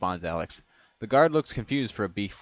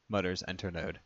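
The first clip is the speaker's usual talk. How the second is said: plain reading voice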